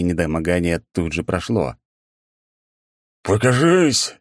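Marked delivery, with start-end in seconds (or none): 3.2-4.2 loud roar muffled inside helmet. A narrator's reading. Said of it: "взревел приглушённый голос из-под шлема".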